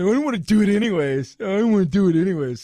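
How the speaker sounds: loud, mock-petulant protest; mock huffy. Host mimicking the spurned star refusing another film, mock huffy. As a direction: protest loudly in a mock huff. Reacts huffily to no more movies.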